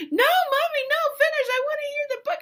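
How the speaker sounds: high, loud tearful wail; mock crying child. Seven-year-old begging mother to finish, imitated crying wail. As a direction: imitate a sobbing kid pleading. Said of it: "bursts into tears".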